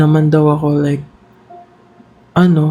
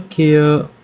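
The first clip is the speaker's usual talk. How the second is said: normal talking voice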